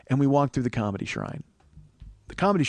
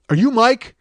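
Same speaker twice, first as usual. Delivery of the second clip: abrupt barman call; 10 semitones higher, 9 dB louder than usual. Retold or imitated. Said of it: "turns around and goes".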